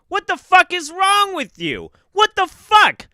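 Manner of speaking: furious outburst at the screen; angry yelling. Real, not acted. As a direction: yell it in disbelief. Streamer yelling at video.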